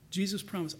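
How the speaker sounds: usual speaking voice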